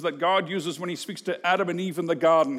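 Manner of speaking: ordinary delivery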